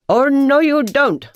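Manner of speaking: loud, high shout; loud high warning shout. A narrator's reading. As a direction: yell to stop the rat. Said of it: "shouted Raj".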